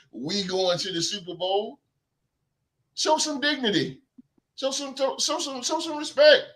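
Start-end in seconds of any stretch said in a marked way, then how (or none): 3.0-6.4 higher, insistent show some respect; preacher-style scolding; scolding plea. Real, not acted. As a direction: scold the fans, demanding respect. Scolding fans.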